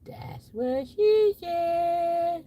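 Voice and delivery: cartoonish drawn-out voice; silly voice punchline. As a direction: stretch it out silly. Punchline in odd voice.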